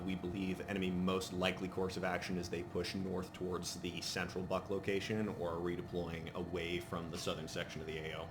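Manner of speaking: ordinary talk, nothing marked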